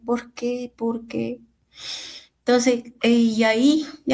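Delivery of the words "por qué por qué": hushed imitation of her crying prayer, trailing into a whisper; mild retold weeping. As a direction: softly re-enact the broken plea. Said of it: "Yo así mismo lloraba".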